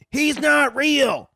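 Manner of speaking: high, loud, drawn-out yell; hammed-up freak-out. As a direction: yell it slowly like a public meltdown, hammed up. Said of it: "be like that one bitch".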